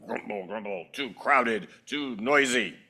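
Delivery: low grouchy complaining; grumbling neighbor. Acted.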